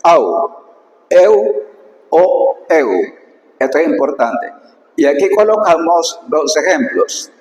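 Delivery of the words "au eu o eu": au, eu y eu cantados con cuidado; au, eu, eu pronunciados. Shown as a demonstration. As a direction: marca bien au, eu, eu. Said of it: "eso va a sonar siempre". (pronunciación griega de au, eu, eu)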